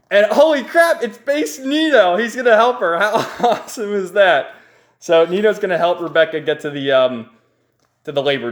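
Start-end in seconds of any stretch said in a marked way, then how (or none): 0.1-4.5 high-pitched, gleeful exclaiming; thrilled fan reveal with a laugh bubbling in; excited hype. Real, not acted. Narrator thrilled by hero's arrival.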